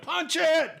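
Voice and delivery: high-pitched mock yell; urgent shouted order. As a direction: holler the command to gun it. Brother shouting an order to pilot.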